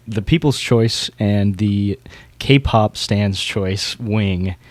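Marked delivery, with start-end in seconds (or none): none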